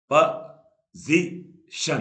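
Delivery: Position very slow and loud, a pause between each syllable. Shown as a demonstration. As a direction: break position into three slow, separate beats. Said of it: "तीन बार टूटा".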